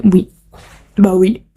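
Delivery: ordinary delivery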